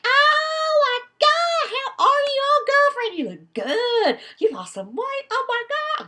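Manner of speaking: very high, loud, sing-song reunion squeal; gushing college girls. Retold or imitated. Old girlfriends reunite after summer break, very high loud squealing. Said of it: "they're like".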